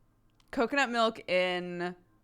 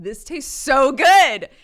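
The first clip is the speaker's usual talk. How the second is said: excited; 5 semitones higher, 13 dB louder, slower than usual. Retold or imitated. Retold tasting a delicious drink.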